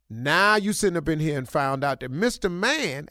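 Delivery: usual speaking voice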